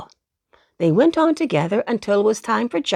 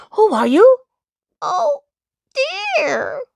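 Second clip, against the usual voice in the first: small voice exclaiming; 12 semitones higher, 3 dB louder than usual. A narrator's reading. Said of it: "exclaimed the voice again".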